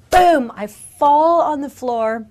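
loud, high shouted boom. Retold or imitated. Preacher reliving falling down, shouting boom. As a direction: explode the word. Power hits her, she falls.

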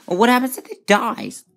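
high squeaky question; squeaky worried question. Real, not acted. Asking viewers in a high voice what happens if the baby dies. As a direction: pitch up in worry.

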